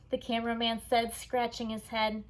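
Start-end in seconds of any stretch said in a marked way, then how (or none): none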